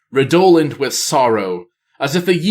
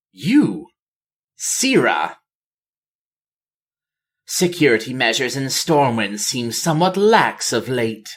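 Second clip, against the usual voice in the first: cold, dry greeting. A narrator's reading. Tyrande's cold greeting to Sira.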